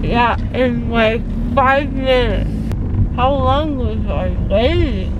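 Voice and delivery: muffled speech after dental work; talking around gauze in mouth. Real, not acted. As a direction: mouth stuffed with cotton pads.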